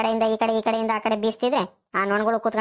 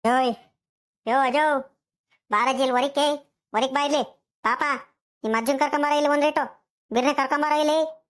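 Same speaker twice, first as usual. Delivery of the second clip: calling out; 4 semitones higher than usual. Acted. Wife calling her husband and grandson out to the cow, hollering. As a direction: holler for someone to come outside. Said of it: "ಯಾಕೆ ಏನಾಯ್ತು ಹಿಂಗ್ ಕೂತ್ಕೊಂಡ್ತಿದ್ಯಾ".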